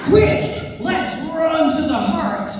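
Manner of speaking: hammed-up American TV warrior queen, slow; bad American accent. Actress mocking her old Xena role in a bad American accent. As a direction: overact it in a terrible American accent. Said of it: "the worst American accent that's ever been seen".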